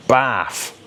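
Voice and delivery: "bath" said as "baff", no th; th-dropped bath. Shown as a demonstration. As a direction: swap the th in "bath" for an f. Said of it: "don't even pronounce the th".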